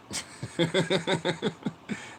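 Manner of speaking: rapid high-pitched chuckle. Real, not acted. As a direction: let out a quick string of high chuckles. Laughs after sarcastic good job.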